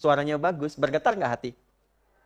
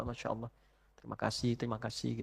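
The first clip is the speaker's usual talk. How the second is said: barely voiced, much softer thanks; whispered thanks; 6 semitones lower, 15 dB quieter than usual. Believer quietly thanking God each day, hushed. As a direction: breathe the gratitude out under the breath. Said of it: "kita merasa bahwa".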